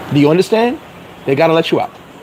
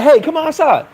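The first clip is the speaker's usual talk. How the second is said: mock cop call; 9 semitones higher than usual. Cops calling from the gate.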